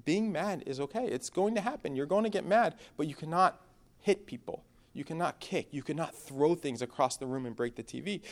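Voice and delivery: calm him down while you set the rules; gentle talk to son. Retold or imitated. Father sitting his angry son down, soft and patient. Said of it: "we go".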